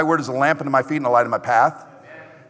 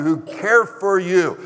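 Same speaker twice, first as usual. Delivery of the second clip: usual speaking voice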